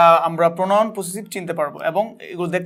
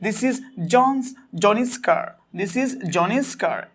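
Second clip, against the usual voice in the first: slow example read; 3 dB quieter, much slower than usual. Shown as a demonstration. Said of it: "আমাদের বাক্যে বলা হয়েছে".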